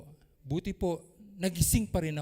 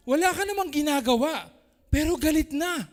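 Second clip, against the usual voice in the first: 1 raised preaching voice; 7 semitones higher, 7 dB louder than usual. Real, not acted. Preacher mocks quick-tempered people.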